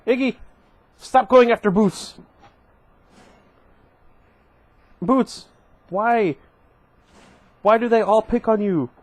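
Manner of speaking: loud, high call at the cat; scolding the cat. Real, not acted. Reader breaks off to scold a cat, calling out loud. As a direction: shout across the room to stop the cat.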